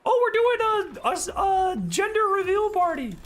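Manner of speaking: high, gushing mock coworker voice; mocking proud parent. Coworker announcing a gender reveal, mocked high and gushing. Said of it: "when coworkers are like".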